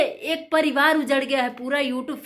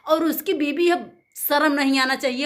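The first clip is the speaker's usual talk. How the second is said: ordinary delivery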